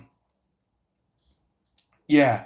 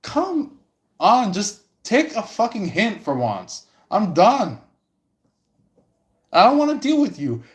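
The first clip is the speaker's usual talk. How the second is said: much higher, fed-up snap; exasperated woman; 9 semitones higher than usual. Retold or imitated. Annoyed woman rejecting a pushy guy, exasperated. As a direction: brush him off, done with him. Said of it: "the woman's like".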